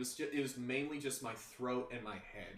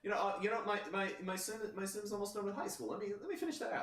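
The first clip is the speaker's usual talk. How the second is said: much higher, fast, hammed-up whiny excuses; lightly mocking, much higher gamer pleading; hooked gamer excuses; 9 semitones higher, much faster than usual. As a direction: play the addict bargaining for one more day. Imitating addicted gamer excuses.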